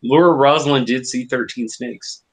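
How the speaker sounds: ordinary talk, nothing marked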